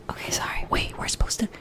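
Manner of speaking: quick whispered correction. Real, not acted. Streamer reminding herself to whisper, whispered. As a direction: catch yourself and whisper. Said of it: "we're supposed to be a whispering streamer".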